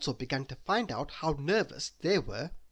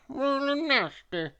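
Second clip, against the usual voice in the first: quartermaster; 11 semitones higher, 4 dB louder than usual. A narrator's reading. Quartermaster voiced.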